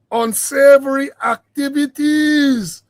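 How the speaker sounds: ACTIVITIES stretched long and loud, pitched high; stressed syllables. Real, not acted. Host hinting at what goes on in the farm, syllables heavily stressed. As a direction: lean hard on the syllables of "unsavory activities", drawn out. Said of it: "I got to emphasize, I got to stress on some of these syllables".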